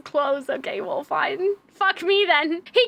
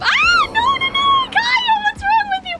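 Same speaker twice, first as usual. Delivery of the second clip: high-pitched startled panic; shrieky, scared protest; panicked outcry; 11 semitones higher than usual. Real, not acted. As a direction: cry out in sudden alarm. Jump scare during play.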